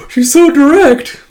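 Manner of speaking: put-on high, loud, drawn-out girlish amazement; scandalized schoolgirl squeal; astonished admiration. Becky gasps at boldness.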